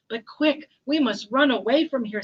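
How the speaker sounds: urgent lion. Acted. Lion hurrying the mouse away, urgent. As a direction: press the listener to hurry.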